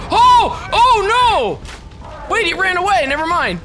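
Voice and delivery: loud, high hunt yells; excited yelling. Real, not acted. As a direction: shout them on in the hunt. Hunt chaotic in game.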